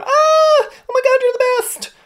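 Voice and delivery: very high loud fangirl scream; screaming fan. Retold or imitated. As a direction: shriek like a thrilled fan. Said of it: "girls aren't coming up screaming".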